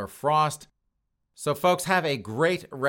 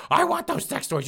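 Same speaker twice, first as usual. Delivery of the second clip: much higher falsetto, insistent demand; mock shrill demand; 4 dB louder than usual. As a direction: squeal it like a woman who won't let go. Victim demands toys back.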